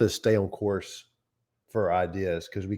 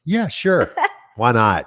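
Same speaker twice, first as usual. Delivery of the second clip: eager yes; 3 semitones higher, 8 dB louder than usual. Retold or imitated. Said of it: "And I was like".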